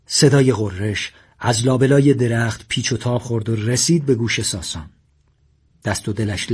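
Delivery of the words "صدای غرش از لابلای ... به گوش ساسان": usual speaking voice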